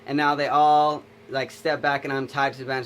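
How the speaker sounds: usual speaking voice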